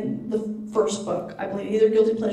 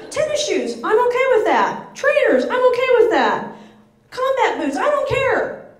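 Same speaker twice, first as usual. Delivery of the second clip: high indignant rant; 10 semitones higher, 3 dB louder than usual. Real, not acted. To the audience about shoes, a high heated rant. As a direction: list each shoe high and emphatic.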